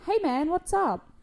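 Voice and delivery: high, slow mock holler; yelled greeting. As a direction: call out a greeting, half-heartedly. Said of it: "I yelled loudly".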